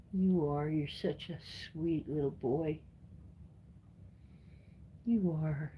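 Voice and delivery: soft, tender cooing to a pet; sweet nothings. Real, not acted. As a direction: murmur softly to the kitty. Cooing love words to the cat.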